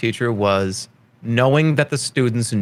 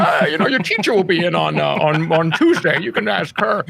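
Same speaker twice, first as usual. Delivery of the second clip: mock flustered substitute; 5 semitones higher than usual. Acts the clueless substitute dodging questions.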